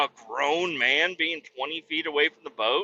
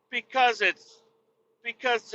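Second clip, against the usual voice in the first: mocking fumble; 9 semitones higher than usual. In-laws mocked fumbling.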